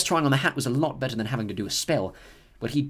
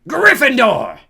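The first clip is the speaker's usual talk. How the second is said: loud, high drawn-out shout; hat shouting; 13 semitones higher, 14 dB louder, much slower than usual. A narrator's reading. The hat shouts its verdict to the hall. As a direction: announce the house with a booming cry. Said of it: "shouted the hat".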